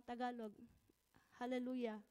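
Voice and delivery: usual speaking voice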